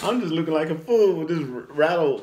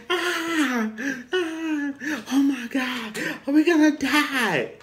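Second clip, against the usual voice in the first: mock falsetto wail of panic; high-pitched screaming man; 10 semitones higher, slower than usual. Tough guy panics in the hailstorm, high mock scream. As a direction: imitate a grown man shrieking high. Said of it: "He has a real high voice".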